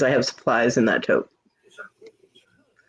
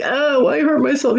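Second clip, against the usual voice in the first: higher-pitched mock complaint; whining hurt kid; 10 semitones higher than usual. Kids complaining to John about getting hurt, mock whine. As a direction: put on a hurt child's complaint.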